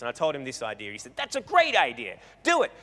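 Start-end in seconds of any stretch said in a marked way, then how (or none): 1.2-2.7 higher, eager urging; retold burst of enthusiasm; enthusiastic approval. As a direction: egg him on excitedly. Retold enthusiastic reply to his idea.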